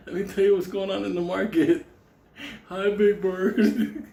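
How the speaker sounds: high puppet voice, drawling out the last greeting; Snuffleupagus. Acted. Caffeine-deprived man greets a bird friend as a puppet creature. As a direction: play a big cartoon creature chatting. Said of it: "Like Snuffleupagus".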